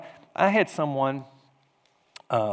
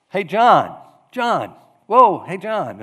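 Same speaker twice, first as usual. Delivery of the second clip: loud, raised hail to get attention; calling out; 6 semitones higher, 10 dB louder, slower than usual. Acted. Pastor wanting to talk to John, calling out loudly. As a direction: call after someone walking off.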